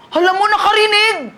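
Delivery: loud, high cry of wonder; amazed exclaim. Retold or imitated. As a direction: burst out in amazement. Amazed at healing. (gulat na sigaw)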